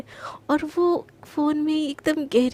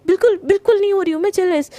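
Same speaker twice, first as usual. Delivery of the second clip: indignant denial; 5 semitones higher, 5 dB louder, faster than usual. Acted. Denying jealousy.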